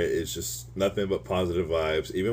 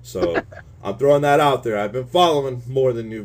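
ordinary talk

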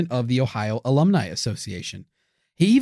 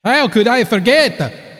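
dramatic; 7 semitones higher, 6 dB louder, slower than usual. Acted. Hammy dramatic reply.

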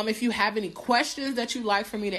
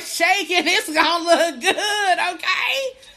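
words bubbling with laughter, pitched up and louder; laughing it out; 9 semitones higher, 7 dB louder, slower than usual. Real, not acted. Host hyping her comeback to live viewers, laughing. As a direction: crack up while hyping yourself. Laughing about shaking on camera.

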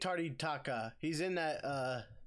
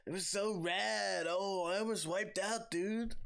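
slow, higher California surfer drawl; California beach surfer; 5 semitones higher, slower than usual. Retold or imitated. Imitating beach surfers for co-host, slow mock California accent. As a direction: drag out every word like a Californian surfer dude. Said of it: "Those beach, uh, surfers".

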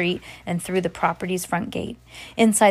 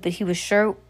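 ordinary delivery

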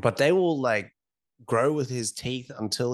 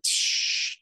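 shushing. Retold or imitated. Assistant shushing again.

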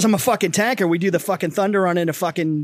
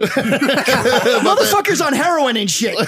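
higher-pitched, laughing rant; comic outrage; 4 semitones higher, slower than usual. Real, not acted. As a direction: rail against it in amused disgust. Comic rant about disliked movie.

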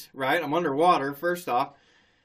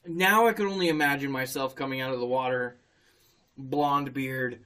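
usual speaking voice

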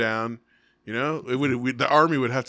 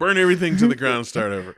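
loud, fast comic rallying cry; mock rallying shout; 3 semitones higher, 8 dB louder than usual. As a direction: rouse the crowd, half joking. Joking call to riot.

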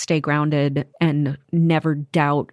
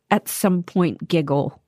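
usual speaking voice

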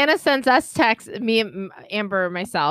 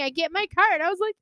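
ordinary delivery

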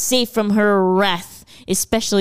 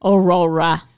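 Australian accent; 3 semitones lower than usual. Shown as a demonstration. Friends trading Aurora in mock Australian accents. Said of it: "in an Australian accent".

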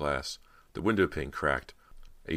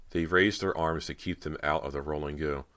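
ordinary delivery

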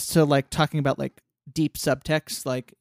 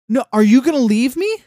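panicked plea; 8 semitones higher, 8 dB louder than usual. Retold or imitated. Snail fears being abandoned.

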